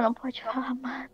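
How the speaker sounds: hushed, barely voiced warning; whisper. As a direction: drop to a whisper. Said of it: "Ele começa a sussurrar".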